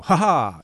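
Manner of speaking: loud, high mock "ha ha"; mock triumphant laugh. Author acting out boundless writerly energy, mock triumphant laugh. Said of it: "the energy that says".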